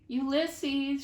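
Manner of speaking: loud calling shout, high; shouting a name into the night. A narrator's reading. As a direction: call out into the dark. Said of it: "shouted Flora".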